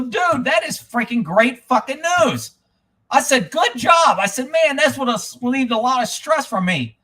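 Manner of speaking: high, animated show of gratitude; retold enthusiasm. Re-enacts his delight at loaner news.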